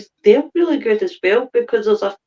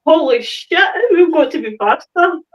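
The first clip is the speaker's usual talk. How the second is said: mock panic; 7 semitones higher than usual. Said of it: "they must have been like".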